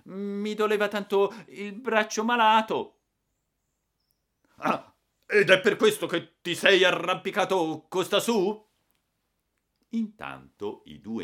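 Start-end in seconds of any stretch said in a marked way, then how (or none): 0.0-3.0 put-on high, loud, tearful-child whine; exaggerated whimpering complaint; whining voice. A narrator's reading. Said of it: "risposi con voce piagnucolosa". 4.6-8.6 high, loud scolding retort; outraged, incredulous shouting; angry sarcasm. A narrator's reading. Angry retort to the boy's excuse.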